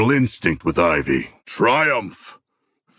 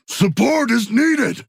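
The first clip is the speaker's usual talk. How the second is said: calling for help; 14 semitones higher, slower than usual. Acted. Combat callout.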